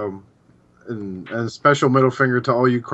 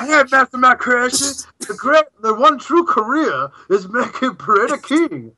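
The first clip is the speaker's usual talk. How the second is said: mock North Korean caller; 10 semitones higher, 5 dB louder than usual.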